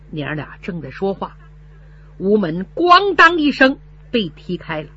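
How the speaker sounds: ordinary delivery, nothing marked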